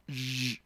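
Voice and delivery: Zh as a buzzing voiced sh. Shown as a demonstration. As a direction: hum the sh into a zh. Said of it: "se pronuncia de manera sonora así".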